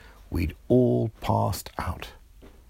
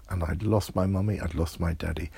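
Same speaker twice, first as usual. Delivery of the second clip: ordinary delivery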